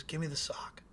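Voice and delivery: breathless eerie whisper of a weird fan; creepy whisper. Retold or imitated. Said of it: "He goes".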